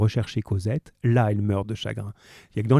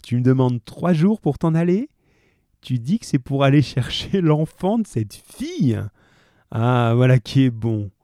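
higher, louder sneer with a laugh; mocking laughter; 3 semitones higher, 4 dB louder than usual. Javert mocking Valjean's plea, higher and louder with laughs. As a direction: laugh in his face.

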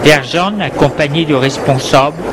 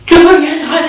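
high call after him; 13 semitones higher than usual. Acted. Jeanne calling after her departing lover. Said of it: "lui crie".

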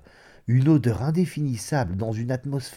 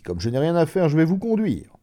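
obliging official; 7 semitones higher, 3 dB louder than usual. Acted. Treasurer politely offers to guide.

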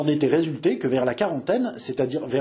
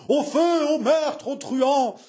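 crying out; 12 semitones higher, much slower than usual. A narrator's reading. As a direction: call for help across the house. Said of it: "criant, vociférant".

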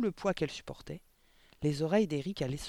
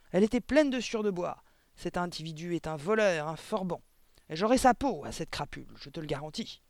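higher, louder indignant rant; ranting headmistress; 4 semitones higher, 4 dB louder than usual. Acted. Headmistress ranting about the father's car, indignant. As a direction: fume about the swindle.

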